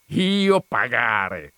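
very slow, mocking drawl of disbelief; sneering scoff. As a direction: sneer it out, savouring the joke. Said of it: "sghignò Ziddima".

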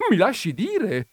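high, loud protest; talking over him. A narrator's reading. Countess shouting down the visitor's interruption, indignant. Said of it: "gli diè sulla voce la contessa".

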